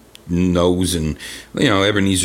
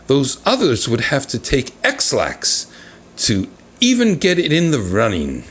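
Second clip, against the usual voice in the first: lively, raised letter-reading voice; reading a letter aloud; 5 semitones higher than usual. Acted. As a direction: read it out like a fan letter.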